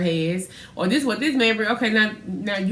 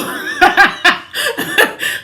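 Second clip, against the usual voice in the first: high, breathy burst of laughter; 12 semitones higher, 7 dB louder than usual. Real, not acted. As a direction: laugh out loud, high and airy. Laughing at brother's truck.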